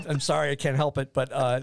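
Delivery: normal talking voice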